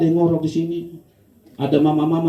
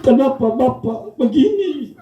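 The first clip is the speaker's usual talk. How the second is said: higher, slow lament over the husband; a wife wailing at his bedside; mock weeping wife; 7 semitones higher, slower than usual. Wife mourning her silent husband, mock weeping. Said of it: "istrinya menangis".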